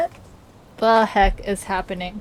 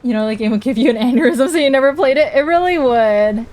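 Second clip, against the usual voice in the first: excited; 3 semitones higher, 7 dB louder than usual. Real, not acted. Excited reply to chat.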